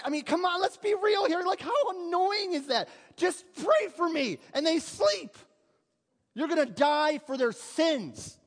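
preacher venting about the disciples who slept; exasperated outburst. Real, not acted. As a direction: let the frustration burst out. Preacher worked up over sleeping disciples.

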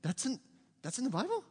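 halting, incredulous rising question; hesitant mock astonishment, lifting at the end; retold disbelief. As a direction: imitate a stunned teen checking what was just read. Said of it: "I was like".

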